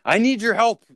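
high, loud desperate plea; panicked customer. Retold or imitated. Imitating a panicked client walking into the store, high plea. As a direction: beg for help like you're scared.

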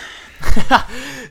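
loud high laugh; burst of laughter. Real, not acted. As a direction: crack up out loud. Laughing at Minecraft movie news.

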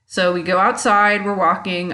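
ordinary talk, nothing marked

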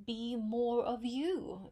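ordinary delivery

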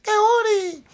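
loud, very high mock call of a name; hammed-up high cartoon yell; imitating the character. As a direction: shriek the name, cracking yourself up. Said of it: "Every time he said her name".

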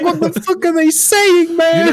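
very high-pitched mock bewilderment; baffled outburst. Caller lost on a Liverpool accent, high baffled exasperation. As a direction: squeal it in comic disbelief.

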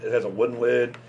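normal talking voice

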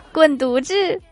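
shouted, high, with a laugh; mock-angry laughing retort. Wife fires back at husband's teasing, mock-angry and laughing. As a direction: snap it back, half laughing.

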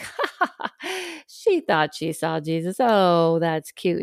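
mock condescending laugh. Adults laughing off a child.

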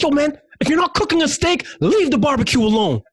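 high, loud yelled challenge; yelling at kid. Retold or imitated. Yelling at a kid messing with the barbecue, high yell. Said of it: "so I yelled at him".

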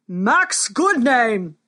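slow, booming royal call; booming warrior king. Acted. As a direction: thunder the name across the arena. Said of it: "thundered the king".

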